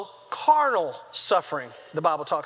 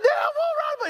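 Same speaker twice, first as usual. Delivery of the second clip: extremely high mock crying wail; hammed-up falsetto howl; screaming child; faster than usual. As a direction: imitate a boy screaming at his dad. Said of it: "He's screaming now".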